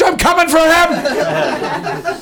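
high, slow hammed-up war cry; mock battle cry. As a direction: bellow it like a pompous general. Mock battle cry at exiting heckler.

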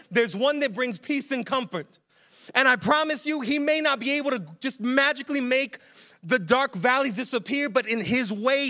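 impassioned preaching. Real, not acted. Preacher urging the congregation through dark valleys, impassioned. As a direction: press the message home with urgency.

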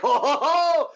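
high, loud laughing gasp; crowd gasp, laughing. Retold or imitated. Crowd reacting as the creature turns, laughing gasp. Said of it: "And everyone's like".

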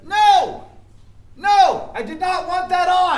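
screaming high and very loud; furious shout. Acted. Boss screaming at the staffer filming his meltdown, furious shout. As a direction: blow up at her at the top of your lungs.

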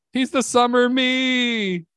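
high, slow, stretched-out delivery; sing-out the catchphrase high and slow; exaggerated signature voice. Comic greeting the audience as billed, high drawn-out voice. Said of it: "This guy talks like this".

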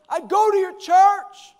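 high, loud, slow mock-fangirl announcement; proud fan. Young lady tells her own pastor, loud gushing imitation. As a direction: imitate an overeager young woman, drawn out. Acting out the admirer.

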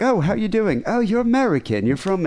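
high-pitched imitation of cheery postal clerks; friendly greeting. Scottish postal staff welcoming an American, imitated friendly and high. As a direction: greet a foreign customer in a high, chirpy voice. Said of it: "They were so friendly".

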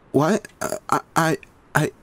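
flustered, caught off guard; stammering. A narrator's reading. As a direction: stumble over the words. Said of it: "Melissa stammered".

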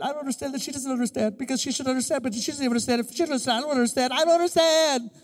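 fast, high, hammed-up weeping complaint; mock crying rant. Husband at the counselor, hammed-up crying and rambling. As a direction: whine it like a man in tears. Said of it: "I'm crying".

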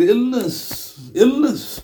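a high, drawn lament on illness; lamenting repeat. Retold or imitated. Preacher voicing Ibn Mas'ud's grief at the ill heart. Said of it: "So Ibn Mas'ud said".

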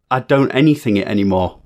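ordinary talk

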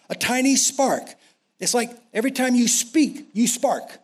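rising preacher's build, high; preaching at full force. Real, not acted.

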